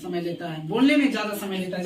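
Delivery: ordinary talk, nothing marked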